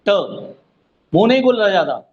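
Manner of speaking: ordinary delivery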